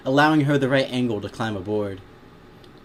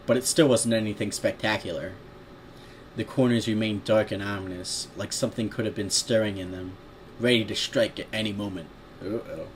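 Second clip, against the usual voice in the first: usual speaking voice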